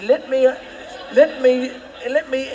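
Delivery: a laughing hero begging for a go; laughing eager quote. Retold or imitated. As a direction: chuckle out an eager let me. Hero eager to kick ball.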